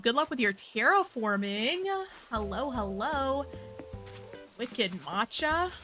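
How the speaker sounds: ordinary delivery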